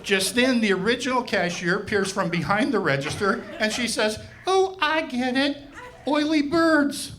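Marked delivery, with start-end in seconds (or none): 4.5-7.1 high, slow dawning Oily birds; dim realization. Retold or imitated. Cashier finally getting the joke, high slow realization. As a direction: let it land slowly, high and pleased.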